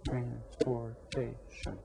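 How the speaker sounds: soft, slow, each syllable apart; syllables counted out. Shown as a demonstration. Teacher counting syllables with the class, soft and slow. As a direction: count through "Transportation" softly, syllable by syllable. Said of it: "How many syllables?".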